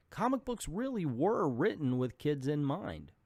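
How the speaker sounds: ordinary delivery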